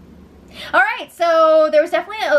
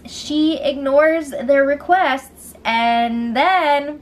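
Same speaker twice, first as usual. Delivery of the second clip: ordinary delivery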